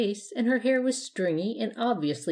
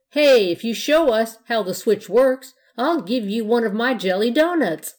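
eager offer; 4 semitones higher, 8 dB louder than usual. A narrator's reading. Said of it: "the biggest ghost offered".

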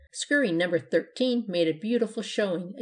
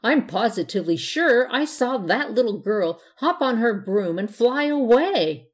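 high, loud exclamation building to the end; screamed amazement; 4 semitones higher, 6 dB louder than usual. A narrator's reading. Lady at the window tells guests what she saw, screaming. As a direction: cry out in astonishment, stretching the last words. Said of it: "gave a scream".

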